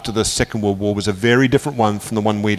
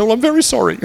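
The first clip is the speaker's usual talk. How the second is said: higher, hammed-up apology; mock apology; 11 semitones higher than usual. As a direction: apologise with a wink. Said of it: "I said".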